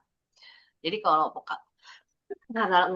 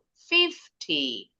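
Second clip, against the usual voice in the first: FIFTY with FIF high, TY dropping; higher tone on FIF. Shown as a demonstration. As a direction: stress the first syllable of FIFTY. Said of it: "Fifth-nya lebih tinggi intonasinya". (FIFTY, intonasi "fif" lebih tinggi)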